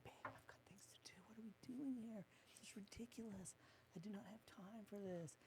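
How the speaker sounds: urgent whispered complaint. Retold or imitated. Husband griping to wife in meeting, hushed whisper. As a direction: hiss it under your breath to your wife. Retelling a private aside to wife.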